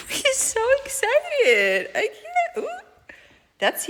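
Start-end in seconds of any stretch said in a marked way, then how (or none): 0.0-2.7 squealing high through real laughter; laughing delight. Real, not acted. Owner overcome with laughter at her excited kitten. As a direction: laugh it out, too delighted to finish.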